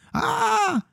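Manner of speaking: very high-pitched mock scream; thin, high yelp of a frightened vampire; vampire shriek. A narrator's reading. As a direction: shriek high without real volume. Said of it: "Wrzasnął pan Zbyszek".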